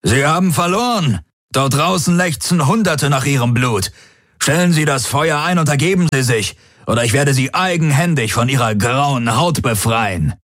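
high-pitched, forceful ultimatum; angry snorted threat. A narrator's reading. Daraios demanding Ylana's surrender, angry ultimatum. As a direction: press the threat home with indignation. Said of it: "schnaubte Daraios".

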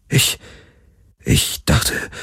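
hushed, halting and barely voiced; shaken stammer. A narrator's reading. Kirai stammers after seeing the thing, shaken. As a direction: falter in a near whisper, with pauses. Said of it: "sie schluckte mit trockener Kehle".

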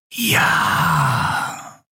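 slow, whispered voice of command; menacing Excellency. A narrator's reading. Ruler demanding the admiral's report, slow menacing whisper. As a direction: whisper each word with drawn-out authority. Superior responds.

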